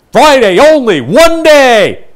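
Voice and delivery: loud, high hard-sell shout; yelling ad announcer. Shown as a demonstration. Announcer yelling a sale at the listener, loud and high. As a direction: bellow it like a pushy radio ad. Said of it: "I hate ads where somebody yells".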